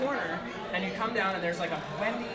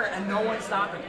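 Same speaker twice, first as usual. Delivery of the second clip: ordinary talk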